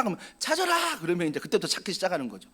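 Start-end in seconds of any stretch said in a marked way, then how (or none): 0.4-1.0 high playful call. Retold or imitated. Child hiding in hide-and-seek calls back to the seeker, high playful shout. Hider calling back in game.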